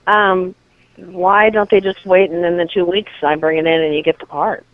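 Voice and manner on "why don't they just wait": ordinary delivery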